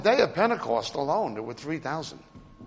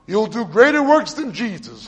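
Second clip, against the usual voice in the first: mocking a money preacher; 5 semitones higher, 7 dB louder than usual. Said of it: "What do they mean by that?".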